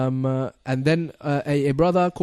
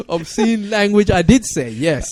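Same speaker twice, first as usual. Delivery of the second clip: laughing admission; 8 semitones higher, 6 dB louder than usual. Real, not acted. Laughing at being teased.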